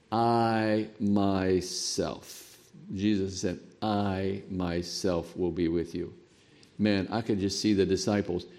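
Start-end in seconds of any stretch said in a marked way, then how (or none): 0.1-6.1 "I myself" drawn out slow and soft; emphatic I myself. Shown as a demonstration. Preacher stressing Jesus's promise, slow emphatic words. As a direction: lean slowly on "I myself". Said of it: "Greek is emphatic".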